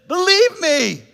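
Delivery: slow, high, loud "Believe, believe me"; pleading Jesus. Retold or imitated. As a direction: beg them to trust you, drawn out. Convincing doubters.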